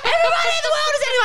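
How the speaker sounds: high mock yell through laughter; retold yelling. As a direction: call out doom to the whole street, laughing. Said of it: "she's yelling out".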